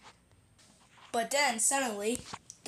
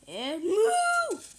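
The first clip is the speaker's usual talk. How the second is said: higher, eager call to move; excited go; 7 semitones higher, 3 dB louder than usual. Acted. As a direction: shout go to the animal. Rider urges animal to go.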